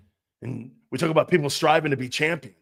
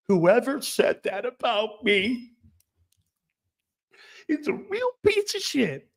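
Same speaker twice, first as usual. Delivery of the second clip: high whiny mock of the player; high mocking impression of a whiny star; mocking Embiid; 8 semitones higher, slower than usual. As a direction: sneer it as the crybaby star.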